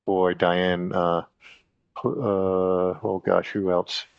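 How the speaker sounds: ordinary delivery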